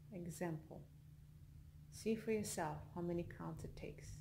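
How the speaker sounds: soft, unhurried meditation voice with long pauses; guided meditation. Acted. Leads a yoga nidra relaxation.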